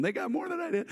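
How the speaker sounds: put-on high-pitched childish whine; complaining kids. Imagined hungry kids comparing portions, high whiny complaint. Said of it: "imagine four hundred kids going".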